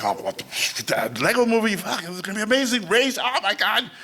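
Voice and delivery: high, gushing re-enacted excitement; super excited. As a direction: start stunned, then rush up into high delight. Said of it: "I'm, like, super excited".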